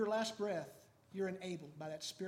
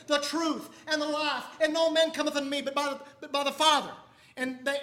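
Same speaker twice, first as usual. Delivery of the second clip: loud, high, fervent sermon delivery; full-force preaching; 6 semitones higher, 15 dB louder than usual. Acted. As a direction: preach it out to the congregation with fire. Preaching Jesus' words aloud.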